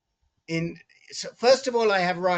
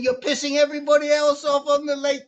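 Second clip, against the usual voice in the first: higher, louder mock scolding; imitating screaming; 7 semitones higher, 5 dB louder than usual. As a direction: retell a stranger's angry complaint, pitched up. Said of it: "she had been screaming at me".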